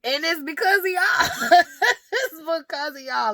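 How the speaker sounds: high, loud, through real laughter; laughing. Real, not acted. Mother blaming strict parents for their kids' rebellion, laughing. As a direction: crack up while making the point. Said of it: "I'm sorry that I'm laughing at this".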